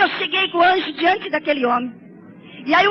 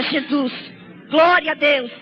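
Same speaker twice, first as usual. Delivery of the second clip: ordinary talk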